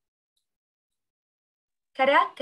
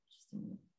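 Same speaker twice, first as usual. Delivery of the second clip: whisper; 24 dB quieter, slower than usual. Real, not acted. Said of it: "aside during class".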